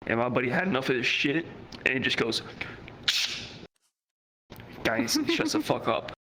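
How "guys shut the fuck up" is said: high sharp retold snap, slower; snapping shut up. Buddy telling the coworker off, sharp and high. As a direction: tell him off hard.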